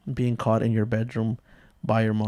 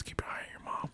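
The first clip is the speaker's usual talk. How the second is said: hushed conspiratorial whisper; secret warning; 20 dB quieter, faster than usual. Retold or imitated. Father secretly tells the kids to watch mom, whispering.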